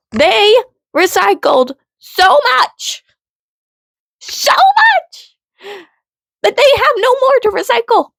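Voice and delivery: incredulous near-scream; shouting disbelief. Real, not acted. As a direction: yell it like it blows your mind.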